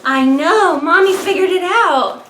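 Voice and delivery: loud, high, laughing cheer; laughing mom triumph. Real, not acted. Mom cheering to her baby son on the floor, loud and laughing. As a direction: crow with delight at finally winning.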